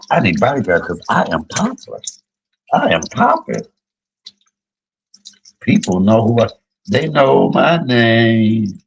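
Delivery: playful diva boasting, pitch leaping up; mock self-important boast. Teller jokes that she needs bodyguards, mock self-important boast. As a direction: play up being famous, drawing out the last words. Acting out own boast of fame.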